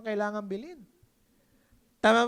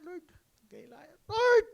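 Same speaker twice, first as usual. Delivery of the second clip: mock desperate prayer; 6 semitones higher, 6 dB louder than usual. Mock angry prayer before exploding.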